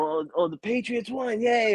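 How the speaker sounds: high-pitched little cheer; imitating little daughter. Dad mimicking his daughter after a Patriots win, gleeful. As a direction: lift it high into a child's yay. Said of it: "and she goes".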